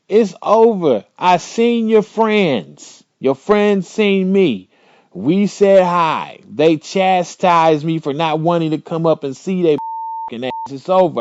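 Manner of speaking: spelling it out word by word; exasperated boyfriend laying it out slowly; retold exasperation. Retold argument, slow and raised.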